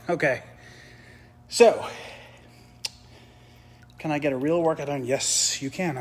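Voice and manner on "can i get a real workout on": normal talking voice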